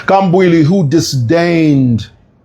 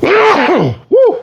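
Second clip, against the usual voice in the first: a sudden loud sneeze ending in a high whoop; a real sneeze that bursts out high; loud sneeze then a high yelp. Real, not acted. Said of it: "I'm a loud sneezer".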